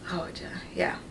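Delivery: breathy whispered aside to herself; whispered under the breath. Real, not acted. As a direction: drop to a whisper.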